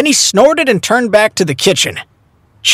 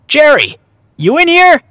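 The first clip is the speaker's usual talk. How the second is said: raised, high-pitched call; 8 semitones higher, 3 dB louder than usual. A narrator's reading. Zach hails the girl in the kitchen, calling out. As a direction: call through to someone in the back. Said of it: "Zach shouted in the direction of the kitchen".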